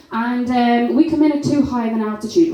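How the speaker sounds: ordinary talk, nothing marked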